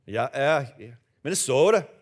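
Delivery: put-on Minnesota accent with a long, stretched O. Preacher teasing Minnesotans in church, Minnesota accent. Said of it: "notorious kind of accent".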